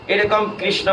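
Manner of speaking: ordinary talk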